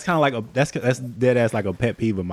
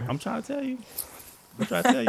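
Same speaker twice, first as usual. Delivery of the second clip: high laughing; 12 semitones higher, faster than usual. Real, not acted. Laughing agreement.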